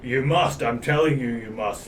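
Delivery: bully them across; guard insisting loudly; insistent guard. Acted.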